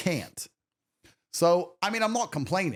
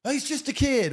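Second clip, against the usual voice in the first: mocking mother; 12 semitones higher, 3 dB louder than usual. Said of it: "mothers will get in the way".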